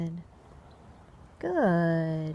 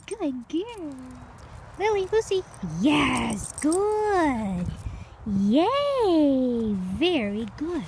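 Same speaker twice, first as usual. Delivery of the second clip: high, bright-pitched puppy praise, louder; gushing over the puppies, higher and louder; excited praise; 6 semitones higher, 3 dB louder than usual. Real, not acted. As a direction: cheer the dogs on in a sing-song high voice. Excited praise for reactive dogs.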